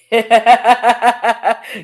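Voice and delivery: bright burst of laughter. Real, not acted. Teasing Jane about her answer.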